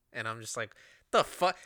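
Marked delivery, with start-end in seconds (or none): 1.1-1.6 higher, louder burst of indignation; retold anger. Speaker mad at her calm in the dream, angry. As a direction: re-enact being mad, sudden and loud. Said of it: "'cause I'm getting mad".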